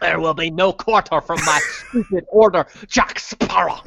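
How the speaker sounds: hammed-up Davy Jones pirate accent. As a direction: pronounce a threat as mock Davy Jones, half laughing. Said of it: "Davy Jones' accent from Pirates".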